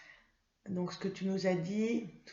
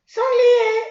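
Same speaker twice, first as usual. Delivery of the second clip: put-on much higher, louder, slow child puppet voice; Mimi; 15 dB louder than usual. Mimi the puppet answering her teacher, high child voice. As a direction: answer slowly like an eager little puppet.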